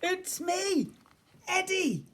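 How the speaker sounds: put-on very high child's voice; Eddie revealing himself. A narrator's reading. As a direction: announce yourself in a piping little voice.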